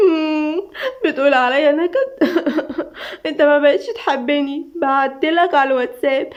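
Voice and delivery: high, hammed-up mock sobbing; fake crying. A narrator's reading. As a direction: wail with obviously faked tears. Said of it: "دعاء بعياط مزيف".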